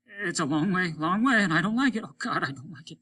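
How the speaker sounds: a falling crewman whimpering in dread; frightened despair. Acted. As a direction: let the fear spill over.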